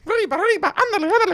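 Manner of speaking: high cartoon Speedy voice; cartoon mouse cry. Riffing cartoon catchphrase, high mock accent. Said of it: "devolved into racism".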